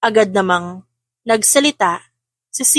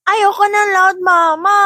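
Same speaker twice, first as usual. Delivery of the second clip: put-on crying child; 9 semitones higher, 4 dB louder, slower than usual. A narrator's reading. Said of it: "umiyak si Sian".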